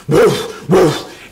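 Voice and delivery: loud booming dog woofs. Retold or imitated. Teller mimicking the big dogs barking, loud woofs. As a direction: bark like a huge Rottweiler. Imitating big Rottweiler barks.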